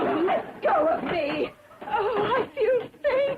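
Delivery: slow, wailing protest rising high; drawn-out, tearful swoon; faint distress. Acted. Guest grabbed by the host, slow fainting wail.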